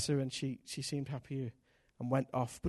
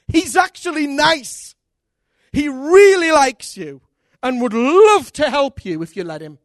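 slow, louder, higher preaching; 8 semitones higher, 13 dB louder, slower than usual. Acted. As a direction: proclaim it to the congregation, slowly. Preaching at full force.

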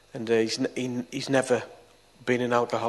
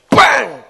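shouted crash sound, high and loud; loud bang; 11 semitones higher, 13 dB louder than usual. Retold or imitated. Telling how his brother hit the floor, loud bang. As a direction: slam the word like a body hitting concrete.

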